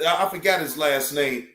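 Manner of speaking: usual speaking voice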